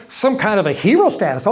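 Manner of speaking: ordinary talk, nothing marked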